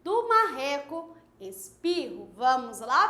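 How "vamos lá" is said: normal talking voice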